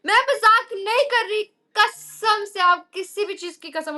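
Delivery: high, loud "I swear"; loud excited swearing; excited insistence. Real, not acted. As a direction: swear to the viewers, all wound up.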